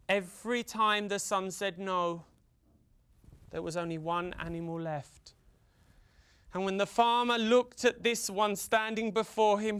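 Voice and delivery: usual speaking voice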